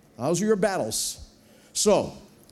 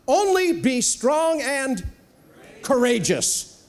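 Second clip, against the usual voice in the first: drawn-out loud divine command; slow booming exhortation; emphatic slow decree; 5 semitones higher, 4 dB louder, much slower than usual. Acted. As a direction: stretch it slowly as God commanding. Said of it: "says this phrase".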